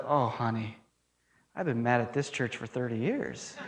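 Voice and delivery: put-on soft, low, deadpan old-timer's answer; quiet retold reply. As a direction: voice the old man softly, dropping low for the punchline. Retold old man's gentle punchline reply.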